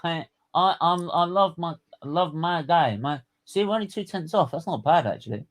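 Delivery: half-mock passionate fan; passionate fan pleading. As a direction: plead your love for the sport, worked up. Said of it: "I'm going mad".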